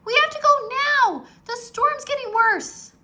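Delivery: high, loud pleading yell; urgent shout. A narrator's reading. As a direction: yell at him to leave. Annie urging in storm.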